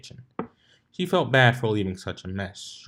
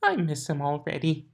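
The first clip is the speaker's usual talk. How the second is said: whimper; 6 semitones higher than usual. A narrator's reading. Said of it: "She whimpered".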